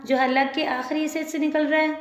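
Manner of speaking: normal talking voice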